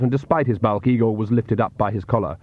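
usual speaking voice

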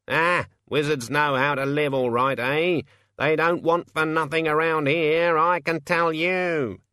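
high, knowing bird voice of a streetwise raven; cocky raven. Acted. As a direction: crow it out like a smug scavenger bird. Raven character voice.

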